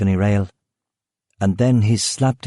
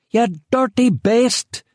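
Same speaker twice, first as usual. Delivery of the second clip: put-on high, slow shrill scream of an old woman; 13 semitones higher, slower than usual. A narrator's reading. Old woman attacking the intruder, high slow scream. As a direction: shriek it at him as an old lady. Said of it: "she screamed at him".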